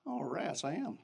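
soft, resigned admission; quiet realization. Retold or imitated. Pastor admitting to himself he is the pastor, softly resigned.

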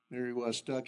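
normal talking voice

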